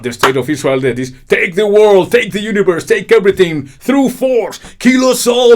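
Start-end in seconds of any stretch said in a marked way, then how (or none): 1.3-5.5 high, loud mocking voice of conquerors; furious hammed-up proclamation; mock shout. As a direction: bark the demands like a tyrant. Mock quote of Israel's stance.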